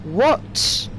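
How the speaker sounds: high, indignant complaint; it's rubbish and you mean it; outraged disbelief. Real, not acted. Indignant at missing server.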